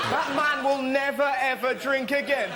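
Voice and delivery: ordinary talk, nothing marked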